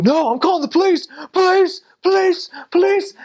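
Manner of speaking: very high, frantic mock shouts; panicked yelling. Injured man refusing hospital, calling police, hysterical. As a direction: call out hysterically, repeating in shrieky bursts.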